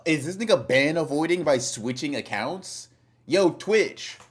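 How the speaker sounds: loud, high-pitched incredulous question; outraged call-out. Real, not acted. Streamer reports a ban evader to Twitch, outraged. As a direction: call for the moderators in disbelief.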